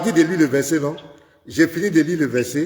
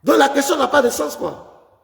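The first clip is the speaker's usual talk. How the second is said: higher, insistent heated pitch; rising heat in a pastor's argument; 6 semitones higher than usual. Real, not acted. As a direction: push the point, getting worked up. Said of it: "calmez-vous".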